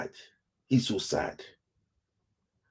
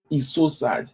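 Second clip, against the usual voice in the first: usual speaking voice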